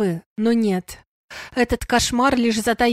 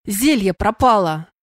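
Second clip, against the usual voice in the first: louder cry of annoyance; vexed exclamation; 5 dB louder than usual. A narrator's reading. Sandr finds the potion missing from his pocket, vexed. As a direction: exclaim it in frustration, with more force. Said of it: "с досадой воскликнул Сандр".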